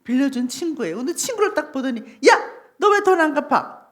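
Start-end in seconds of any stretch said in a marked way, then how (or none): 2.3-3.9 higher, louder acted outburst; angry demand. Creditor confronts the friend who owes him money, angry demand.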